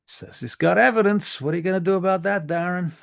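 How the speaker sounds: high, loud dismissive shouting; scornful rant. Acted. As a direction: wave it off, outraged.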